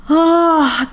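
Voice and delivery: high, loud swooning "ah". Real, not acted. Reader overcome by the book's yearning, high swooning "ah". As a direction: let out a big high "ah".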